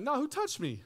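high, surprised "who touched me"; Jesus puzzled. Retold or imitated. Preacher voicing a puzzled Jesus in the crowd. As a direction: act out Jesus looking round, baffled. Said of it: "He's like".

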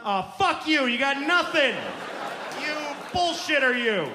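high, loud comic rant; mock-furious shouting; exasperated outburst. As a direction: blow up like a worn-out parent. Tired dad's mock outburst.

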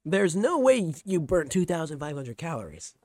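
loud, incredulous imitation of stepmom; corrected stepmom quote. Streamer re-quoting stepmom doubting calorie count, loud mock disbelief. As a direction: redo it clearly, punching the disbelief. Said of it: "She said".

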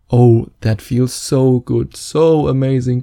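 slow, drawn-out fake sigh of pleasure; hammed-up bliss, savoring every word; mock pleasured moan. Mock pleasure during a hug.

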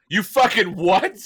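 high, loud, slow disbelief; mock shocked boss. Boss stunned at the quitting, high, loud and slow. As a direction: stretch out the outrage. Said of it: "And they're like".

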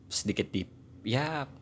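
ordinary delivery

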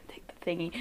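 hushed, breathy sneaky whisper. Acted. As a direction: whisper it like a pickpocket at work. Sneaky pickpocket move narrated.

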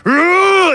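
high, loud mock roar of rage; rage monster roar. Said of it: "But he's like".

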